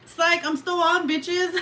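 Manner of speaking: high, loud, teasing call; gleeful shout. Real, not acted. Fake-out for viewers saying goodnight, gleeful shout. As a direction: shout it high as a prank reveal.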